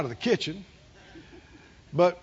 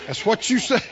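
ordinary talk, nothing marked

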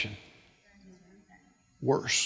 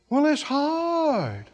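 sad whine; 5 semitones higher, much slower than usual. Retold or imitated. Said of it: "in the tone of the voice".

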